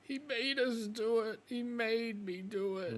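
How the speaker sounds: high, slow, whimpering plea for help; pleading hurt wolf. Acted. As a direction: beg like a wounded young wolf. Wolf defends himself.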